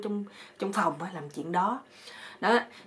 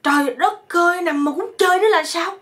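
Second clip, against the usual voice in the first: high, loud comic outburst; hammed-up shouting at biting mosquitoes; pained yelling; 10 semitones higher, 9 dB louder than usual. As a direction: yell out in stung exasperation. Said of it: "Chích đau quá rồi ảnh mới la lên".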